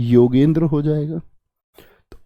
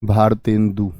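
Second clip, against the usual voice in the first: slow, drawn-out Bharatendu; teacher slowly revealing Bharatendu to the class; slow answer; 8 semitones lower, 3 dB quieter, slower than usual. Shown as a demonstration. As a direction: spell out Bharatendu slowly, syllable by syllable. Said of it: "तो क्या बना?".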